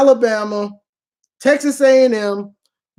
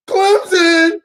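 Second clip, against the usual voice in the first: mock announcement; 6 semitones higher, 4 dB louder than usual.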